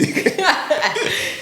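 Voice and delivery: high-pitched burst of laughter. Real, not acted. Guest laughing at his own embarrassment. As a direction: crack up remembering it.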